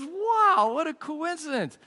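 high-pitched mock astonishment; amazed businessman. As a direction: leap up high on a big stretched wow.

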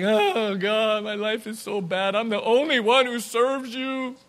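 high-pitched, hammed-up whiny complaint; whining. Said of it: "He's just whining. He's like".

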